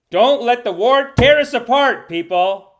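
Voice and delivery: loud, slow appeal to the chat; rally the viewers loudly, drawing it out; loud plea. Acted. Pleading with quarreling viewers.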